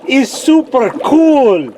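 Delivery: put-on high, drawn-out Russian-accented amazement; hammed-up Russian wow. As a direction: gush slowly in a mock Russian accent. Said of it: "the best of my Russian accent".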